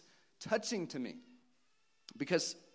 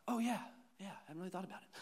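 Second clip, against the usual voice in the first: lightly voiced young boy, higher; offhand child's reply, trailing softer; imitated kid; 6 semitones higher, 5 dB quieter, faster than usual. Voices his sons' casual reply.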